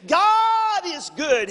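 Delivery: 1 exclaimed praise. Real, not acted. Preacher exclaims mid-sermon.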